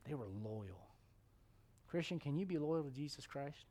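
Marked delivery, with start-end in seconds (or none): 0.1-0.9 low, hushed reflection; soft, low old man's musing; moved old David. Acted. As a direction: think it aloud, humbled and quiet. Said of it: "You know, he thought".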